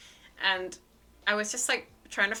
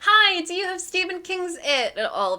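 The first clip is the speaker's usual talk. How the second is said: high, loud over-bright greeting; imitating a jittery, too-eager customer; adrenaline-fuelled request; 9 semitones higher, 5 dB louder than usual. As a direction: pipe up high at the front desk. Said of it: "adrenaline from that conversation forced me".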